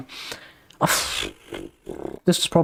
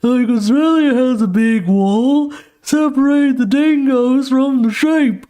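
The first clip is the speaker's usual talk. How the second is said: higher, slow, swaggering mock Trump; Donald Trump impression; 7 semitones higher, slower than usual. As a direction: draw it out like a boastful politician. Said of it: "I'm surprised Donald Trump never said".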